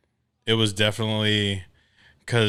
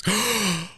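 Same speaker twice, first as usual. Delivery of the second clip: mock gasp; 12 semitones higher than usual. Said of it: "And she's".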